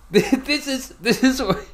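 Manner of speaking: giggling, pitched high, through the words; pointing at the list, cracking up with glee; laughing. Real, not acted. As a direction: laugh it out in delight. Gleeful over the podcast list.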